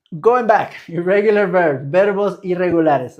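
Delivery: loud teacher call. Real, not acted. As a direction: call the class back to the topic, loud. Teacher calls class back loudly.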